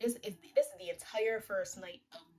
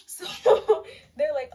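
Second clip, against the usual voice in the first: loud laugh through the words; 7 semitones higher, 15 dB louder, much slower than usual. Real, not acted. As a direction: laugh out the line. Laughing while telling.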